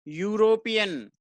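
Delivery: slow, drawn-out "यूरोपियन" starting with "yoo"; pronunciation of "European". Shown as a demonstration. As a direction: stretch "यूरोपियन" out, opening on a Y glide. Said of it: "क्या पढ़ेंगे इसको".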